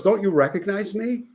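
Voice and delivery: high-pitched imitation of a coy woman; coy challenge. Redhead to cemetery workers in the checkout line, playfully challenging. Woman teases men in retold story.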